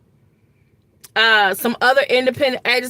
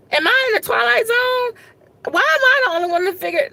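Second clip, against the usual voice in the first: exasperated outburst; 8 semitones higher than usual. Real, not acted. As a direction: throw the question up in exasperation. Venting frustration at politics.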